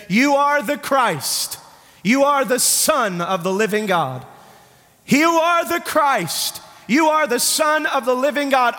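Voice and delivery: high, drawn-out declaration; ringing proclamation. Acted. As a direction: proclaim Peter's confession to the congregation. Preacher proclaims Peter's confession.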